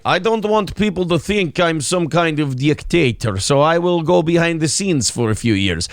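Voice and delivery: mock Russian statesman; Putin in Russian accent. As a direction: explain in a comic Russian accent. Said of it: "you can do a Russian accent".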